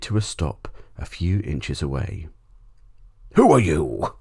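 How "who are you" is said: loud roaring demand; lion yelling. A narrator's reading. Lion yells at the trespassing boy, loud and angry. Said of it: "the lion yelled at my father".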